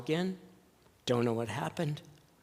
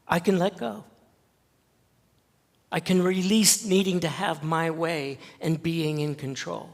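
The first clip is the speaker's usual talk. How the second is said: louder, higher, rising preacher's fervor; preaching at full force; 3 semitones higher, 8 dB louder than usual. Real, not acted. Pastor urging his church to surrender, in full fervent preaching. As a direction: proclaim it to the congregation, swelling.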